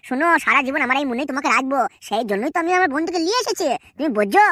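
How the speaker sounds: calm the angry wife down; soothing husband. Acted.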